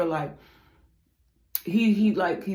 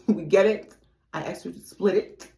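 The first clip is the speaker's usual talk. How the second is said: normal talking voice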